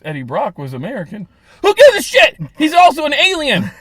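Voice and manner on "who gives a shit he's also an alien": louder, higher, exasperated rant. Real, not acted. Cohost ranting about Venom's accent, loud and exasperated. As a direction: blow up at the pointless casting choice.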